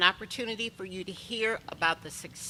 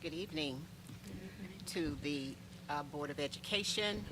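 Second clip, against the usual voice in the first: softly addressing the board, halting; soft, hesitant start; 2 semitones lower, 7 dB quieter than usual. Real, not acted. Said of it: "I'll speak with my inside voice".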